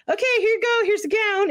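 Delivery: high-pitched, breezy handover; chirpy staff imitation. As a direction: imitate a nurse handing something over. Staff briskly hand over gown, retold.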